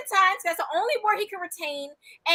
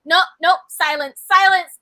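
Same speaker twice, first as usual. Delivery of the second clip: loud mock-imperious orders; commanding silencing; 3 semitones higher, 10 dB louder than usual. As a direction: demand silence, louder on the last word. Co-host shuts down the partner.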